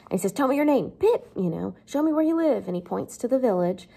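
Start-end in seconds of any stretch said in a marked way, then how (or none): 0.3-2.7 demanding convict. Retold or imitated.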